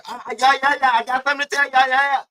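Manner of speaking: high, rushed "yeah yeah, I got something"; mock eager gossip. Imitating Reed stirring drama, high and eager.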